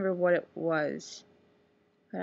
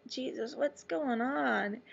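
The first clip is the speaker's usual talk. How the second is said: groggy confusion; 6 semitones higher, 3 dB quieter, much slower than usual. Retold or imitated. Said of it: "and I was like".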